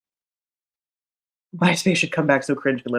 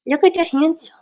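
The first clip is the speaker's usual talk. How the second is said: high gushing coo at the cat; high, louder pet-talk gush; 11 semitones higher, 6 dB louder than usual. Real, not acted. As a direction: swoon over the cat, pitched up. Cooing over the cat.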